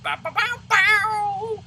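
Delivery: high mocking guitar-riff "bow"; porn music riff. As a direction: sing the cheesy riff way up high.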